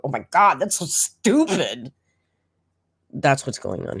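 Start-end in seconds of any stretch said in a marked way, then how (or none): 0.1-1.9 mock self-scolding. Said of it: "she's gotta talk about".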